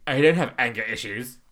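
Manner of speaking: loud, irritated shout; angry outburst. Retold or imitated. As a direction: snap it out defensively. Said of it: "that whole, like".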